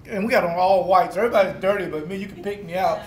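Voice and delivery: usual speaking voice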